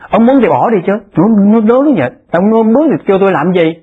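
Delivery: stern scolding. Retold or imitated. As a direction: scold them for calling you at all. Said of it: "phải nói lớn vậy".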